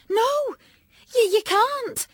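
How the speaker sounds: high, loud, panicked protest; a frightened child begging; desperate. A narrator's reading. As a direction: stammer out a desperate no. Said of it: "I said desperately".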